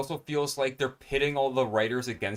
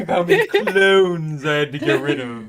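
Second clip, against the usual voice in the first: loud, higher, hammed-up cartoon lion voice; Snagglepuss impression; 4 semitones higher, 10 dB louder than usual. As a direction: camp it up like Snagglepuss. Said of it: "all I hear is Snagglepuss".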